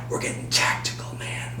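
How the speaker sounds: whispered tactical joke; mock-secret whisper, playful; hushed hype. Joking to viewers about Tactic radios, whispered.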